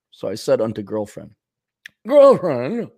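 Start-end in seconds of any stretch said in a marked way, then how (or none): 2.1-2.9 mock-grand call. Mock-grand call to his girlfriend.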